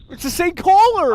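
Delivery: high, loud and slow exclaim; excited. Real, not acted. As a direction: announce it in disbelief. Recognizes the returning caller.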